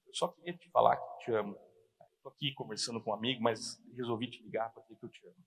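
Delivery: very soft, low loving phone call; tender. Retold or imitated. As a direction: tell your wife gently, just for her. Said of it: "Fala com carinho".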